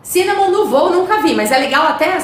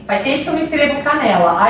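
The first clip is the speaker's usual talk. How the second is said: normal talking voice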